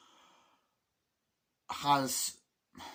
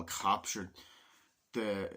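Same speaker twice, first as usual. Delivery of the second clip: ordinary delivery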